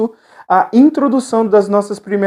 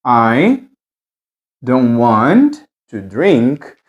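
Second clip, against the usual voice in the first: slow model sentence; 6 semitones lower, much slower than usual. Shown as a demonstration.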